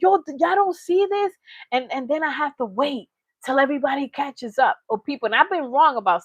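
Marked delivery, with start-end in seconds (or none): 0.0-1.3 mock scream. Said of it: "I'm always the one screaming like".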